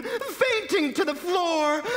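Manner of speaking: high, slow swoon. A narrator's reading. Narrator tells of the woman fainting, high swooning voice. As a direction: draw it out high as if fainting away.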